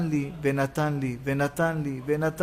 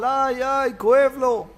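high-pitched mock yelp; imitated cry of pain; 7 semitones higher, 4 dB louder than usual. Preacher imitating a fallen man, mock cry of pain. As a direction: act out someone crying out after a fall. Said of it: "צועק, אדם לא עלינו נפל".

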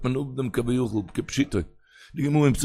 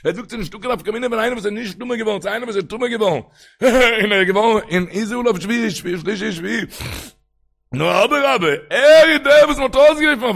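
high sing-song argument, building louder; impassioned teaching; 10 semitones higher, 6 dB louder than usual. Acted. Rabbi explaining the Rambam to his listeners, impassioned teaching. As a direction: chant the distinction, rising to a cry.

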